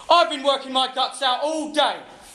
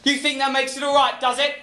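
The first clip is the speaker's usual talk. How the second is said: ordinary delivery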